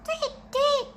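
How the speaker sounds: high chirpy birdsong voice; bird chirp. Acted.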